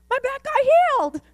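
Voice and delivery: very high squealing cry of joy; ecstatic. Retold or imitated. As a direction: shriek it like you can't believe it. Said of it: "the way that I thought she would respond".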